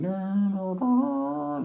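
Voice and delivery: sung-out fanfare up high; imitated triumphant music. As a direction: hum a mock heroic fanfare. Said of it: "triumphant music going like".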